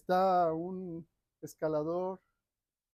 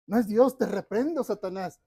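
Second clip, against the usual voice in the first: sharp, much louder and higher shout; indignant rebuke; 3 semitones higher, 9 dB louder than usual. Acted. Climber refusing God's command, loud indignant rebuke. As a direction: cast the voice away, shouting.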